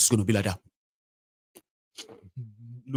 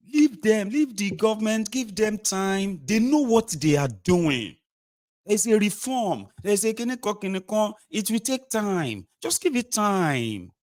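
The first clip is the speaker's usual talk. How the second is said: government defender; 7 semitones higher than usual. Acted. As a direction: plead for patience, high and stretched.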